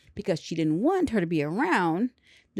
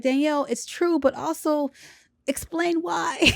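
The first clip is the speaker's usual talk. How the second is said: higher, slowing into a shout on the end; exasperated plea; 8 semitones higher than usual. Real, not acted. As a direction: plead with her, rising to a shout. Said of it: "But I'm just like".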